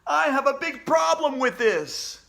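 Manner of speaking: high, loud protest; upset kid shouting. Acted. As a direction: yell your objection.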